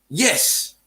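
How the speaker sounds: ordinary talk, nothing marked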